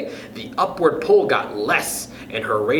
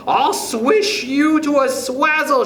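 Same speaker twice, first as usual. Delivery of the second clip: high, slow, furious threat; raging shout; 11 semitones higher than usual. A narrator's reading. Wife raging at her husband with her stick, high slow shout. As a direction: spit out each word in rage. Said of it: "she shouted".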